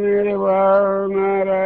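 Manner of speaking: very slow, drawn-out mock greeting; mimicking nature-cure doctor. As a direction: stretch the greeting out like a listless doctor. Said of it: "బాగా షోగా నెమ్మదిగా మాట ఉసూరుమంటే". (ప్రకృతి వైద్యుడి నెమ్మది పలకరింపు అనుకరణ)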